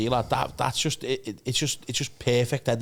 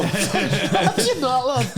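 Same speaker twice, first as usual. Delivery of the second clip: laughing; 11 semitones higher, 5 dB louder, much slower than usual. Real, not acted. Laughs at the story.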